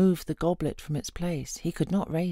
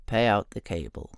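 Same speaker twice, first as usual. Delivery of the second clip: a deep, lower voice for Nos; Nos, low and steady; 10 semitones lower than usual. Acted. Nos tells the troll to pay out the cable, low. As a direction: say it low and level, in command.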